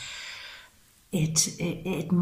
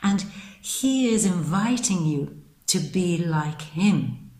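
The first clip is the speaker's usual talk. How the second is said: ordinary delivery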